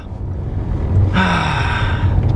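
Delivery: drawn-out exasperated sigh; long frustrated sigh. Real, not acted. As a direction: let out a long defeated sigh. Frustrated after being told to weld.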